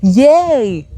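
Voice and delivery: high, loud, gleeful cosmic voice; playful universe voice. Pretend. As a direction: cheer like the universe pranking her. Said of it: "the universe was like".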